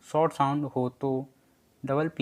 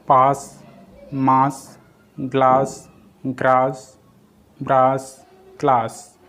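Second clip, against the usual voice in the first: each word louder and set apart by pauses; loud word-list reading; 7 dB louder, slower than usual. Shown as a demonstration. Teacher to students, calling out the example words. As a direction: call out every example word clearly for the class.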